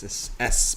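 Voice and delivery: "espresso" with a clear S, no X; corrective pronunciation. Shown as a demonstration. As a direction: spell out the es at the start of "espresso". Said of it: "Is there an X in it?".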